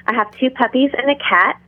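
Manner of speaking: usual speaking voice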